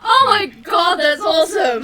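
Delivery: high, loud and elated; bursting with excitement after a lucky roll; thrilled exclamation. Real, not acted. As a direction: shout it in real delight. Real joy at a lucky dice roll.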